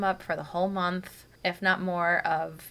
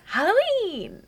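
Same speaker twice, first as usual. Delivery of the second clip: put-on high, louder toddler "Halloween"; imitating toddler; 14 semitones higher, 6 dB louder, much slower than usual. Mom imitates her toddler answering her. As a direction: squeal it like an excited little girl. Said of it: "she goes".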